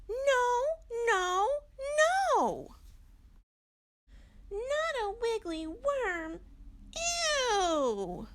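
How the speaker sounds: very high, loud little owl voice refusing; Little Owl protesting. Acted. Little Owl rejecting a worm baby, high and loud squeal. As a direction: squeal a child's no no no at a gross idea.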